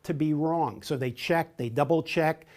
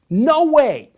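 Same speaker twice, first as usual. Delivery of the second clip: much higher and louder, flat-out denial; emphatic refusal; 10 semitones higher, 9 dB louder than usual. Shown as a demonstration. Trainer shows how a direct person rejects an idea, loud and emphatic. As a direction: bark a firm refusal. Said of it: "use gestures and vocal intonation to emphasize".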